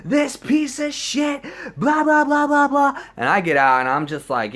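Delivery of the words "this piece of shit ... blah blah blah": high, loud mocking shriek; high-pitched mock tirade; imitated screaming. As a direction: scream accusations like an outraged girl. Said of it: "She starts screaming and shit".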